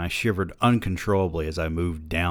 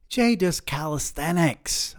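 mock-indignant, much higher and slow; 11 semitones higher, slower than usual. Husband defending his friend to his wife, indignant. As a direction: protest with wounded pride. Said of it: "I said indignantly".

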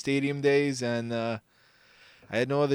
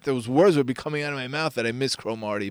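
ordinary talk

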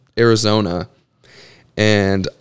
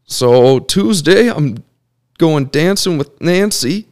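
imitating great uncle; 6 semitones higher than usual. Playboy uncle listing his dates for the week, high voice. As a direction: list the dates proudly, climbing higher. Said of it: "I just do all their voices".

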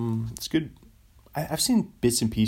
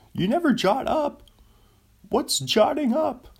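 high, loud Seinfeld-style yelled punchline; mock Seinfeld; 3 dB louder than usual. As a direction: yell it up high like a sitcom comic doing his bit. Repeats Seinfeld bit.

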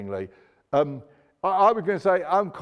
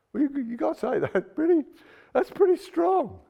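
amazed; 9 semitones higher than usual. Real, not acted. Reacting to Jesus's bold claim.

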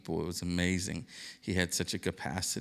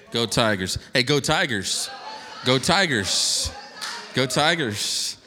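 loud chanted Go Tigers, higher and louder; team cheer; 6 semitones higher, 7 dB louder, slower than usual. Real, not acted. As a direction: cheer it like a stadium crowd.

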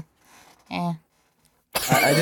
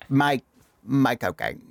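Michael Caine impression; slower than usual. Shown as a demonstration. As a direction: mumble it in your best Caine. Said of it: "the most British voice you can muster".

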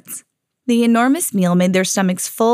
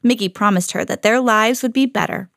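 plain reading voice

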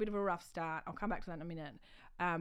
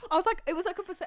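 high, loud laughter spilling into words; giggling aside; laughing; 12 semitones higher, 5 dB louder, much faster than usual. Real, not acted. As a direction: crack up at your own joke. Laughing at own pinger joke.

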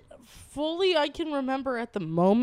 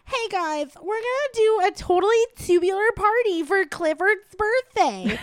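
put-on higher, bubbly Valley Girl accent; 9 semitones higher, 3 dB louder than usual. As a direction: gush it like a Valley Girl teen. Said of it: "trying to do a, like, a Valley Girl accent".

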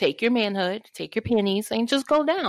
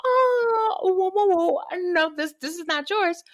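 much higher startled whoas; shocked refusal; 10 semitones higher than usual. Retold or imitated. Turning down the guy's picture request, higher shocked whoas. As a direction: recoil and refuse. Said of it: "I was like".

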